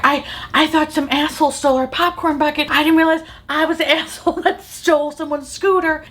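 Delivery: high-pitched, flustered mock confession; retold panic. Grandma realizing she's the thief, hammed-up panic. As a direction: imitate panicking, voice up high, amused. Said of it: "now the woman's panicking".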